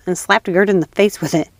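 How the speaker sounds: plain reading voice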